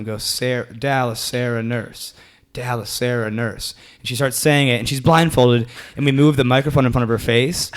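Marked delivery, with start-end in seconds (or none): 0.2-3.8 soft, measured beat on each word; rhythmic recital. Retold or imitated. Comic showing volunteer how to repeat it, rhythmic recital. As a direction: say it evenly like a repeated chant. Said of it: "say that in this rhythm".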